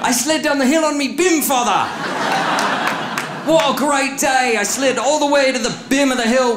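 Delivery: high, hammed-up British child; bad British accent. British child telling father about sledding, mock British accent. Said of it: "sound like we're a British family".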